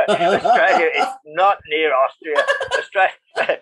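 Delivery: laughing through the words; chuckling as he corrects the mixup; amused, laughing correction. Real, not acted.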